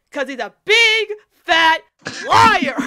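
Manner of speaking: loud, high mock-outraged call-out; gleeful, emphatic accusation; playful accusation. Real, not acted. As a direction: punch each word, building to a loud "liar".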